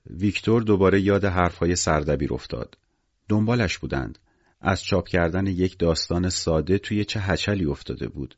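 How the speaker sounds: plain reading voice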